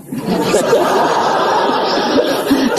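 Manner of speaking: high breathless laughter; real laughter up high. Real, not acted. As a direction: burst out laughing at the joke. Laughing at own story.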